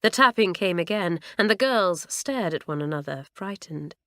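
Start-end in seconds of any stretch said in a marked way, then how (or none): none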